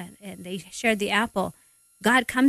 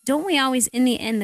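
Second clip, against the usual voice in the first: normal talking voice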